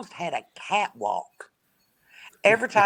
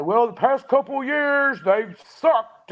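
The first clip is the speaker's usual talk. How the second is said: higher-pitched imitated griping; mock complaining; 7 semitones higher, 4 dB louder, slower than usual. Imagined negative fans griping about the team, mock complaint. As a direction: put on a grumbling fan.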